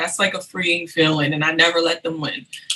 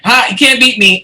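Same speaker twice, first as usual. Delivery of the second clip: teasing taunt; 4 semitones higher, 9 dB louder than usual. Retold or imitated.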